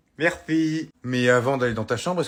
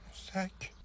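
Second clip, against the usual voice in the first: whisper; 6 semitones higher, 17 dB quieter than usual. Acted. Boy realises he forgot bag.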